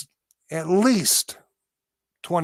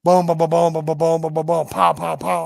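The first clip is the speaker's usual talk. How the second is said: imitated gunfire; 5 dB louder than usual. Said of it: "We start shooting".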